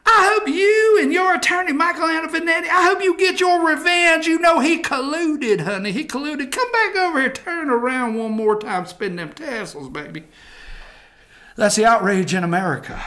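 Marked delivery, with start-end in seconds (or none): 0.1-10.2 high mock-earnest pleading, dying away at the end; hammed-up fan cheering on a dancer; outraged Texas guy. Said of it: "I told her".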